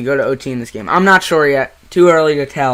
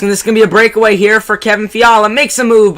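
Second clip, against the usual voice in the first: rising breakaway call, louder and higher; excited; 9 semitones higher, 11 dB louder than usual. Acted. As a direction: build up the rush.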